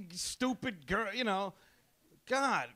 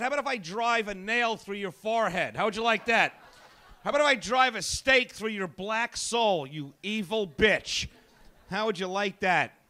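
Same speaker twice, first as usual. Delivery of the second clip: loud, rising fury at the woman; snarling comeback to a gold digger; angry rant; 3 semitones higher, 7 dB louder than usual. Retold or imitated. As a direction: threaten her with building rage. Ranting reply to rich woman.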